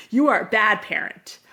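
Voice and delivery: loud mock blame from a cross kid; mock child's accusation. Angry kid blaming a parent, mock accusation. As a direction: push the accusation out loudly, lightly acted.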